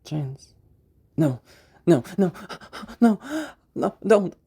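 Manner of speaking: rising, pleading panic; sudden fear as the chains fail; panicked. Shown as a demonstration. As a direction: voice breaking into alarm. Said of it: "Vocal change".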